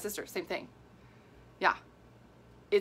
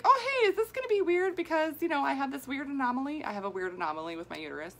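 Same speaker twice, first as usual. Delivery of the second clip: much higher, sweetly naive asking; innocent high voice; 6 semitones higher, faster than usual. Retold or imitated. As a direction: play it dumb and innocent, way up high. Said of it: "I was like".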